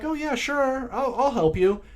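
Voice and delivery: high-pitched mock helpfulness; imitated eager helper. Voices hunter agreeing to help.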